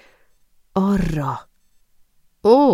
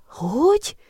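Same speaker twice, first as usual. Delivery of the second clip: surprised; 12 semitones higher than usual. Acted. Shocked at the bought vials.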